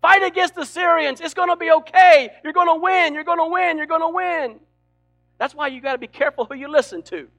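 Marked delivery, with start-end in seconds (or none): 0.0-4.6 hammed-up shout of the false prophets, higher; mock-cheer them into battle. Preacher playing the false prophets hyping the king to war, loud and high.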